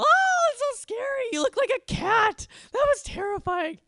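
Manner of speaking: unimpressed big sister sarcastically pretending to be terrified; sarcastic fake fright. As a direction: ham up being scared to mock the prank. Prank flops, "nailed it... failed it.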